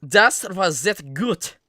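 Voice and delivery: slow, loud, halting German; halting phrasebook tourist. Shown as a demonstration. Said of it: "It means that, it means it was very good".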